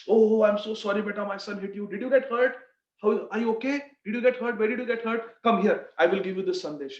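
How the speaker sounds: playing a sorry dad soothing a little one; acted fussing, apologetic father. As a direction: fuss over a hurt child with pleading concern. Father consoles a hurt child.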